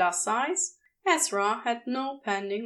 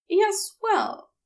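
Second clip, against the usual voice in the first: higher, gentle fussy gentleman; Ezra voice; 10 semitones higher, 4 dB louder than usual. A narrator's reading. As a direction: lift the voice for the prim angel.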